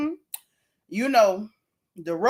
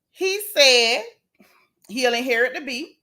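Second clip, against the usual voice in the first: ordinary talk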